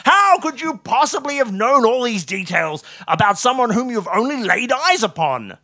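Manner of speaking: high-pitched, loud incredulous question; irritatingly loud. A narrator's reading. Astonished Watson questions Holmes's deduction, irritatingly loud. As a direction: gush with astonishment at an annoying volume. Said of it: "possibly an irritatingly loud voice".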